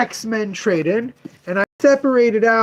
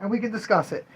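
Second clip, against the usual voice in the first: higher, softer mock customer voice fading out; imitating the collector; 6 semitones higher, 5 dB quieter than usual. Shop owner mimics a customer asking him to name a price, higher and softer. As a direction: play the collector casually angling for an offer. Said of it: "So he was like".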